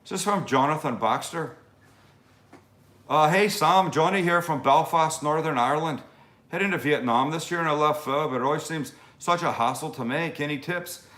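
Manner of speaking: hearty amateur Irish brogue; Irish accent. Shown as a demonstration. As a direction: read it out in your best Irish accent. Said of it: "allow me to read this with my best Irish accent".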